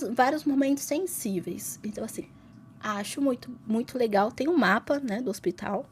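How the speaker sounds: ordinary delivery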